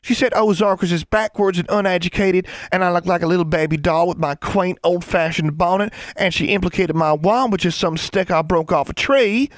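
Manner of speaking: put-on high, indignant little-girl rant; high-pitched sing-song country-child mockery; mocking. A narrator's reading. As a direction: mimic the insults in a high, mocking voice. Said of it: "raised her voice and wiggled her hips side to side mockingly".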